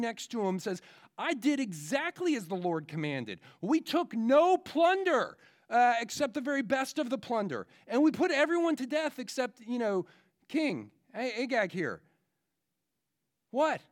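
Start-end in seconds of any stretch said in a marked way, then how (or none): 1.2-12.0 shouting over the noise. Retold or imitated. King shouting his excuse to the prophet over the animals. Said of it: "shouting over the screaming". 13.5-13.8 sharp high loud what; incredulous what. Acted. Preacher reacting to the king's excuse, incredulous and loud.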